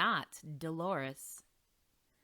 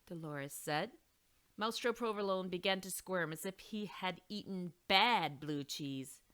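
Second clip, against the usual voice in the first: ordinary delivery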